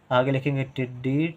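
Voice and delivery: ordinary delivery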